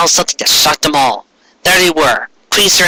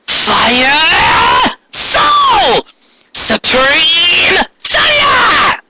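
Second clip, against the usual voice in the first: attack call; much slower than usual. Acted. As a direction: yell out the attack names. Attack names called out.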